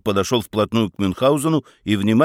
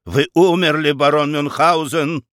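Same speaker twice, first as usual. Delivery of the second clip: выше и громче, в сильном волнении; взволнованно, торопясь убедить; 5 semitones higher, 4 dB louder than usual. A narrator's reading. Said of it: "взволнованно объяснил Рамкомпф". (Рамкомпф взволнованно возражает барону: выше и громче)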